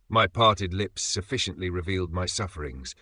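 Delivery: normal talking voice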